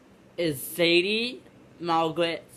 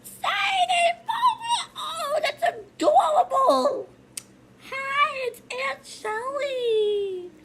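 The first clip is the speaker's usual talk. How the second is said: very high-pitched, gushing squeal; ecstatic young aunt. Acted. Aunt meeting her sister's first baby, ecstatic squealing. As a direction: squeal every line as if overcome with joy.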